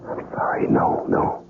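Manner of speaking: barely voiced, hushed apology; whispered denial. Cornered, denies remembering.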